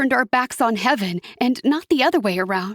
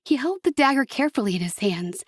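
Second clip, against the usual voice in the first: ordinary delivery, nothing marked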